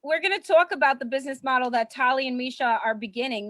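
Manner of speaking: ordinary talk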